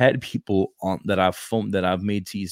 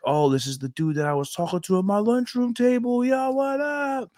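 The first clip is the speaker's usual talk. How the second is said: imitating excited kid. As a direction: squeak it out like a thrilled teen. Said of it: "he's like".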